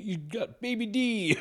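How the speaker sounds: high-pitched put-on jeer; mocking quote. Retelling the beating of a rival's nephew, high mocking voice. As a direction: say it as a high, drawn-out mock taunt.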